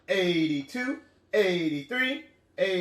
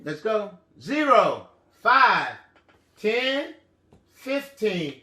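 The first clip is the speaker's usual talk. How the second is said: ordinary talk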